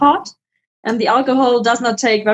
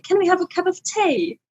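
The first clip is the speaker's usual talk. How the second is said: "tea" as "tay", higher and softer, British; mock British accent; 5 semitones higher, 5 dB quieter than usual. Shown as a demonstration. British version of asking for tea, higher and softer. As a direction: say "Can we have a cup of tea?" the British way. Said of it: "And in Britain, it's like".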